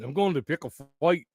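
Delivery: put-on Scottish film quote; Scottish accent. Recalling another favorite Braveheart line, Scottish accent. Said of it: "those are my two favorite lines from Braveheart".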